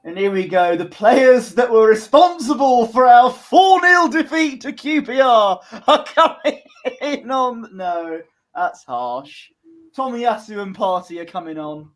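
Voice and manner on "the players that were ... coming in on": high, loud hammed-up introduction; mock announcer. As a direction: announce them grandly, breaking into a laugh. Mock introduction of substitutes coming on.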